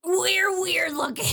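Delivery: high, slow cartoon henchman voice; put-on high goofy voice; goofy sidekicks. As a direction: drag it out like a silly sidekick. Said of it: "her sidekicks come out and they're like".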